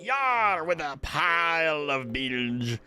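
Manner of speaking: theatrical pirate with stretched words; swooping pirate read-out; pirate speak. Acted. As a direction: roll through it like a sea dog. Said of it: "Pirate speak?".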